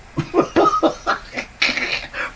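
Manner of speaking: breathy high laughter. Real, not acted. Laughing at mirror joke.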